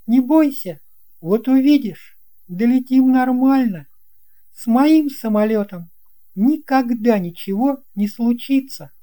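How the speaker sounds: put-on calm a nervous friend as the boy pilot; boy pilot reassuring. A narrator's reading.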